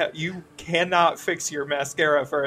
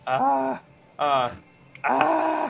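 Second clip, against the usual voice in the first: loud high ah sounds; 10 semitones higher, 6 dB louder, much slower than usual. Pretend. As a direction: shout out short vocal noises. Vocal warmup noises.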